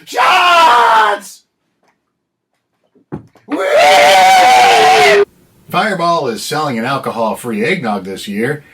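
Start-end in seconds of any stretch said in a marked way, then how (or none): 0.0-5.2 put-on high, loud drunken whoop; party whoop. Party cry.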